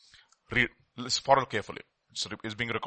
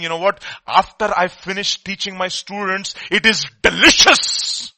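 delighted; 4 semitones higher, 7 dB louder than usual. Retold or imitated. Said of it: "And he's saying".

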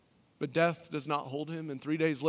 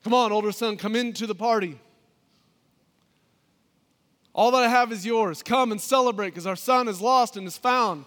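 higher, louder pleading father; father's voice; 6 semitones higher, 9 dB louder than usual. Acted. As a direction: call the older son in, loudly. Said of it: "The story ends".

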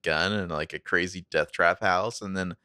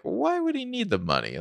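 incredulous high question. Retold or imitated. Marco questions Jenna's story.

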